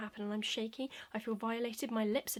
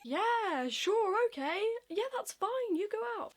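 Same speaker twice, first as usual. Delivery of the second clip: high, louder hammed-up permission; imitated flustered teacher; 10 semitones higher, 4 dB louder, slower than usual. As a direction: put on an over-accommodating high voice. Imitates teacher letting her go.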